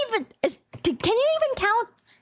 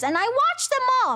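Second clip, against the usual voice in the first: emphatic; 7 semitones higher, faster than usual. Real, not acted. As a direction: blurt it out proudly. Emphatic exclamation.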